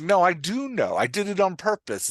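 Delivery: emphatic mock self-defence; insistent protest. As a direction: push back at the doubting reader. Writer protesting to imagined reader.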